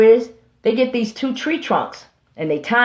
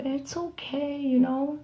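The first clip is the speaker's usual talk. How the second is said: much softer, higher false comfort; soft and higher fake soothing; calm reassurance; 5 semitones higher, 10 dB quieter, slower than usual. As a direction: coo it like a fake friend. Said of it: "talking to him in a calm voice".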